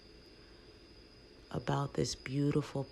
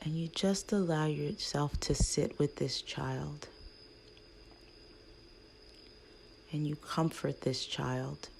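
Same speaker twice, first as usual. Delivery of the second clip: guided meditation. Acted. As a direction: ease the listener gently toward their inner child.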